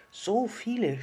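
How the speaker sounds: amazed. A narrator's reading. Little star hears of millions of children, high and amazed. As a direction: marvel as a small storybook star. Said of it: "staunte das kleine Sterndl".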